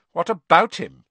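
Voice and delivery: hard voice. Acted. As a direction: shut him down coldly. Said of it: "in a hard voice".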